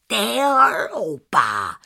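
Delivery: a cross aunt scolding. Acted. (teta hubuje nazlobeně)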